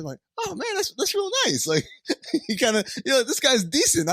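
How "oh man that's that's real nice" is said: much higher, light imitation of a pleased guy; grateful partygoer. Host imitating the guy he hated thanking him, higher.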